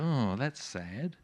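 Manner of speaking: sad sigh. Retold or imitated. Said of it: "I read that story and go".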